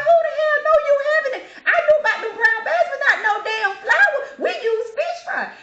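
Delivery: high, loud laughing outburst; mock outraged, laughing. Imitating the lady in the store, laughing outrage. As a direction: squeal her outrage through laughter.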